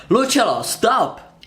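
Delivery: high, loud urgent call; shouted warning. A narrator's reading. Arkin calls to Lucilla nearing the trap, urgent shout. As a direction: call out to stop someone.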